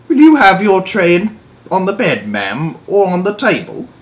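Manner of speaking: high, polite servant voice; a deferential elderly maid; old maid. Acted. Maid offers the Queen her tray, polite high voice.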